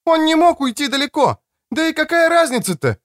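very high woman's call; shouting after him. Acted. Woman calls after the running man, high shout. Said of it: "кричала мне вслед Креция".

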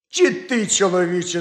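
an alarmed wife crying out; shocked outcry; exclaiming wife. A narrator's reading. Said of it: "скрикнула їжиха".

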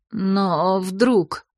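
ordinary delivery, nothing marked

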